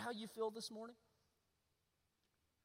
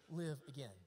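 ordinary delivery

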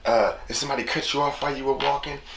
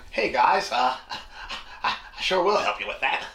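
put-on work voice; 3 semitones higher than usual. Man mimicking someone talking at work, put-on work voice. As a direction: exaggerate the polite, helpful act from work. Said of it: "you don't even talk like that".

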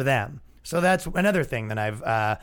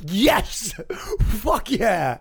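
hammed-up cheer, pitched up; mock enthusiasm; 5 semitones higher, much slower than usual. Comic imagining critics' wished-for agreement, mock enthusiasm. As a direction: act out an over-keen yes.

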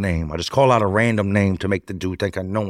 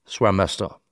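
plain reading voice

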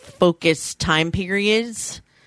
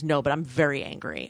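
quiet, low, retold anger; calmly stated anger; 4 semitones lower, 7 dB quieter than usual. Quoting roommate answering why she isn't yelling, calmly stated anger. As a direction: insist on it softly, without yelling. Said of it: "so you're not yelling about it".

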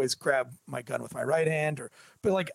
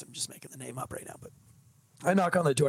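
ordinary delivery

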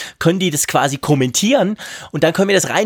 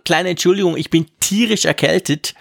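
ordinary delivery

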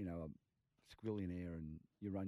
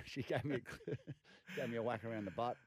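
ordinary delivery

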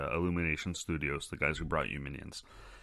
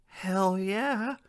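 gleeful cheer; 13 semitones higher than usual. Acted. Cheering about hiding bills.

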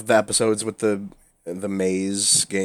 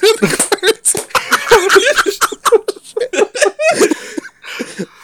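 shrieking fit of laughter; can't stop laughing, high squeals; hysterical high laugh; 7 dB louder than usual. Real, not acted. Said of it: "I can't stop laughing".

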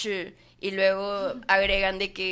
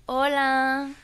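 a higher, louder hola in a fresa voice; posh fresa hello; 5 semitones higher, 6 dB louder than usual. Pretend. Asked for a fresa greeting, a posh fresa hola. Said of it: "mándame un saludo bien fresa".

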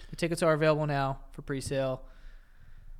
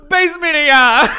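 laughing; 10 semitones higher, 16 dB louder than usual. Real, not acted. Cracking up after flubbed name.

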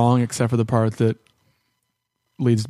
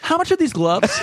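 mock clueless hero; 13 semitones higher than usual. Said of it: "I love that you were like".

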